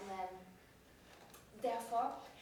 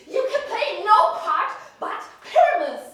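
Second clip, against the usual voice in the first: furious laughing shout; really angry; 10 semitones higher, 18 dB louder than usual. Shown as a demonstration. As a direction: shout it with real fury. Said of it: "Lauter, lauter. Wer richtig wütend".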